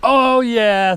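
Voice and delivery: high happy whoop; excited cheer. Real, not acted. Cheering after clearing switchback.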